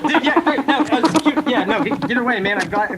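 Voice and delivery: high panicked yelps. Acted. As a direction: squeal in fear at the weasel. Ferret thrust at him.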